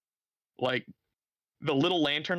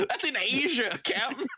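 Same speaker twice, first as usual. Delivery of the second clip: laughing; 12 semitones higher than usual. Real, not acted. GM laughing while answering.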